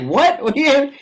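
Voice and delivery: high, startled questioning; retold disbelief. Teller reacting to injured player, acted disbelief. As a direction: replay your baffled reaction, pitched well up.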